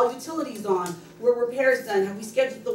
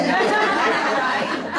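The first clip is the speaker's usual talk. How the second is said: laughing; 6 semitones higher, 5 dB louder than usual. Real, not acted. Laughing at her own story.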